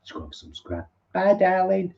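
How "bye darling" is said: fond goodbye. Pretend.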